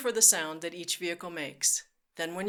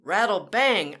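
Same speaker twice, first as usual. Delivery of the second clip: louder rattle and bang; 7 dB louder, slower than usual. Shown as a demonstration. Teacher banging like a rig, loud. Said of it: "your turn to make the sound".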